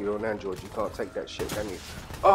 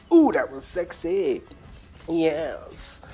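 put-on sultry drawl; 5 semitones higher, much slower than usual. Savouring a gory kill.

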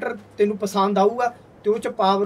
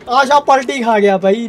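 surprised shout; 7 dB louder than usual. Real, not acted. Tractor just flipped over.

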